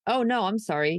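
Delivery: ordinary delivery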